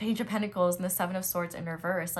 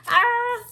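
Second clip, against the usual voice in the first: delighted high gasp; 9 dB louder than usual. Real, not acted. Another card reveal.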